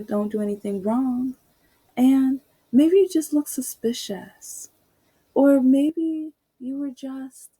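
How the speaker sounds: mock the smug mother-in-law; imitating a preachy older woman; condescending woman. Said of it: "she said some other ridiculous things".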